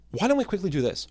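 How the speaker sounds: ordinary talk, nothing marked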